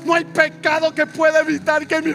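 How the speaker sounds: building to a sustained preacher's shout; full-force preaching. Real, not acted. Preacher proclaiming nothing stops God's love, full-force shouting. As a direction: shout it out relentlessly to the congregation.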